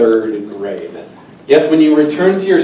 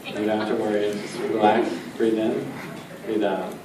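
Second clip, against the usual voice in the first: hypnotic induction; 3 semitones lower, 8 dB quieter than usual. Acted. Stage hypnotist softly putting volunteers under, soothing murmur. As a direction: lull them down into trance.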